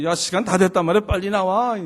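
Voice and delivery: 버럭하며 빠르고 크게 재촉; 참다못한 아빠가 목소리를 높여 다그치듯; 성질난 아빠. Retold or imitated. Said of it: "막 성질이 나가". (성질내며 재촉하는 말투)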